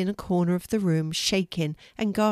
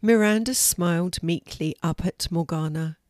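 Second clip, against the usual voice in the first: plain reading voice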